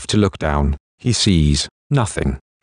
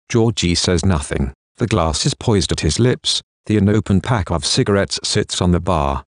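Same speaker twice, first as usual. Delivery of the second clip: ordinary delivery, nothing marked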